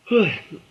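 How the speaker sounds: a loud, tired sigh; weary sigh. Real, not acted. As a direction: sigh out the frustration. Tired reviewer sighs.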